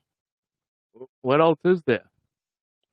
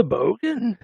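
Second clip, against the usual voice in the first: higher-pitched try at an Australian accent; attempted Australian accent; 9 semitones higher than usual. Shown as a demonstration. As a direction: have a go at sounding Australian, pitch swooping. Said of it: "How do I say it with an accent".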